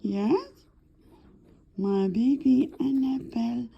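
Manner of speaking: normal talking voice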